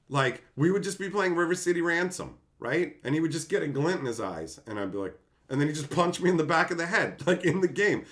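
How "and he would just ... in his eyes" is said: normal talking voice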